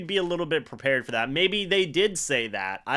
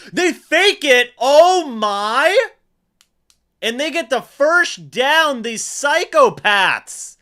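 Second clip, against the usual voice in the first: high, loud disbelieving yell; stunned shout; 9 semitones higher, 9 dB louder, slower than usual. Real, not acted. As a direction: scream like you can't believe the fake. Shock at a fake punt.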